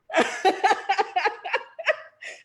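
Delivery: long high laughing fit; real high laughter; teary giggle. Real, not acted. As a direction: giggle helplessly at your own tears. Laughing at her own tears.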